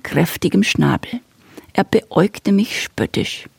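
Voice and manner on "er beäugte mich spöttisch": plain reading voice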